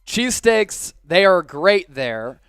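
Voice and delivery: ordinary delivery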